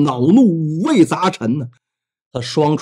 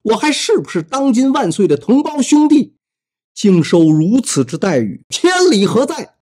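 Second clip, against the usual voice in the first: higher, pitch rising to an outraged cry; indignant protest; 7 semitones higher than usual. Acted. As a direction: demand justice, stung and offended. Prince protests harsh treatment.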